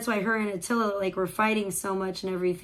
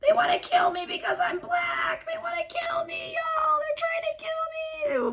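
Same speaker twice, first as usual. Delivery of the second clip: very high shrieking wail; hysterical shrieking victim. Retold or imitated. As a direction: scream that they want to kill you. Acted arrest, crying out.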